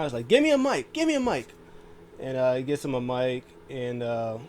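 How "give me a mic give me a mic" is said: high-pitched mock yell; yelling demand. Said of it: "he's yelling the whole time".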